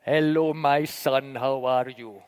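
very low, slow archbishop's greeting; big deep Nigerian voice. Retold or imitated. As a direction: imitate a deep-voiced Nigerian spiritual father. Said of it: "in his big, deep Nigerian voice".

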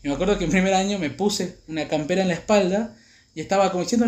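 ordinary delivery

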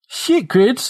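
high-pitched, alarmed protest; dismayed kid. A narrator's reading. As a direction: blurt it high, in dismay at being cornered. Dismayed kid confronted with a bat.

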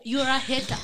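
loud mock name-calling; mock accusation. Content creator mimicking haters' insults, loud mock accusation.

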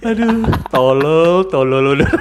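slow, drawn-out groan through laughter; laughing exasperation. Real, not acted. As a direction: drag out the words while still chuckling. Groaning at the silly mix-up, laughing.

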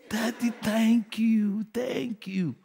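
high, slow, hushed thanks fading to a whisper; grateful daughter. Retold or imitated. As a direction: imitate a daughter's soft, drawn-out thank you. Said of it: "You needed to see the thank you that followed".

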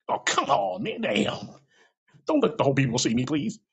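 high, embarrassed pleading protest; exasperated plea. Retold or imitated. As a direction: beg to be left out of it. Pleads over being spotlighted.

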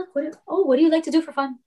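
high-pitched, friendly distracting question; cheery distraction. Helper to panicking coworker, high and coaxing.

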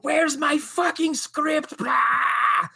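high, slow, shouted rant; angry yell. Retold or imitated. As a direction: yell furiously down the phone. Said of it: "an angry voicemail".

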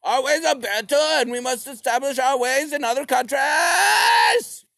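high, loud, hammed-up revolutionary cry; mock Trotsky shout. Trotsky shouting to the comrades, high and loud. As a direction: yell it like a fired-up Trotsky. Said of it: "Trotsky was like".

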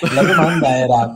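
laughing through the words; giggling while talking. Real, not acted. Laughs mid-sentence while explaining.

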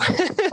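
a sudden high laugh; high laugh of disbelief; high burst of laughter. Real, not acted. Reacting to mother's surprising answer.

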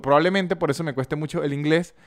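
ordinary talk

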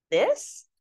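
high, questioning little fish; incredulous young fish. Acted. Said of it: "says the young fish".

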